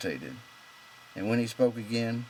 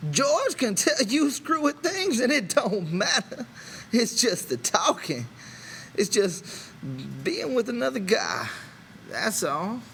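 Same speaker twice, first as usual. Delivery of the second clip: much higher and louder, slow and emphatic; rising excitement; 14 semitones higher, 5 dB louder, slower than usual. A narrator's reading. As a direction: burst out, carried away. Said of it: "His excitement had increased".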